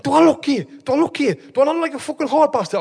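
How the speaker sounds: high, affronted mock challenge; retold angry outburst. Telling mates how he squared up to the driver, high indignant challenge. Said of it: "fucking lost it".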